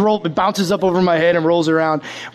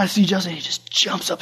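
ordinary talk, nothing marked

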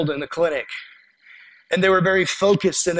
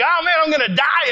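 high-pitched, hammed-up panic; mock panic; 9 semitones higher than usual. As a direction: act out a comic freak-out, pitch way up. Retold panic over scorpion.

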